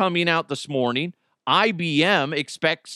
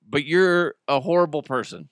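usual speaking voice

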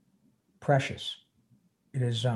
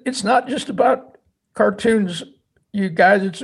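higher, louder, voice straining with grief; emotional veteran; 5 semitones higher, 3 dB louder than usual. Retold or imitated. Veteran telling the actors about fallen friends, close to tears. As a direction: push through tears to make them understand. Veteran tells why show mattered.

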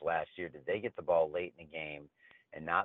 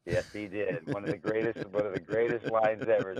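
ordinary talk, nothing marked

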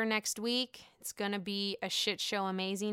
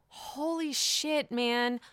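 shocked; 2 semitones higher, much slower than usual. Real, not acted. Shocked at the reveal.

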